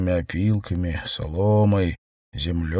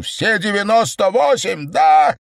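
much higher and louder outraged shout; furious shout; 12 semitones higher, 4 dB louder than usual. A narrator's reading. Secretary demands an answer about the dead herd, shouting. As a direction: hurl the question at him. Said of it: "закричал Кедрин".

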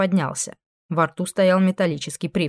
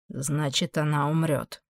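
low, much softer growling demon; beastly growl; 3 semitones lower, 7 dB quieter, slower than usual. A narrator's reading. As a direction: growl it under your breath like a beast. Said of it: "звериное рычание".

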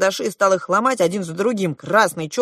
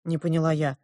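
ordinary delivery, nothing marked

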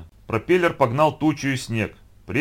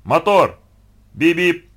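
high, loud set call; loud, punchy director's cue; shouted film command; 5 semitones higher, 8 dB louder, much slower than usual. A narrator's reading. Director's commands over loudspeaker.

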